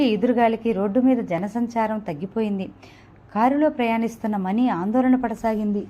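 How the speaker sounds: usual speaking voice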